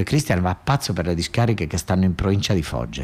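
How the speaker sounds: ordinary delivery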